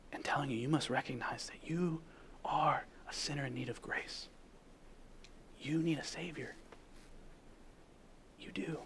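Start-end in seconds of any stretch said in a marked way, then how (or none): 0.1-4.3 soft whispered plea; hushed pleading whisper. Acted. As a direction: whisper it close, like a confession. Intimate appeal whispered.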